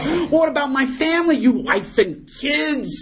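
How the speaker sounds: high, hammed-up pleading; comic desperation. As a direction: plead for the family, stretching the last words. Drunk protests losing family.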